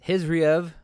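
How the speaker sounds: "Hizriev" starting on a plain breathed H; H pronunciation. Shown as a demonstration. Host tackling fighters' names, "Hizriev" with an H. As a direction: say "Hizriev" with an H, no K sound. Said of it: "or with just emphasizing the H".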